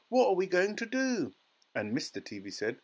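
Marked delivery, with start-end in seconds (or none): 0.1-1.3 high, helpless lament; wailing. A narrator's reading. Mother at a loss over tiny son, wailing. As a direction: wail the question, voice way up. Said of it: "wailed Missus Teavee".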